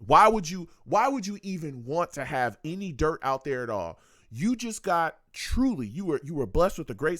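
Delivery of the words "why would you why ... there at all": ordinary talk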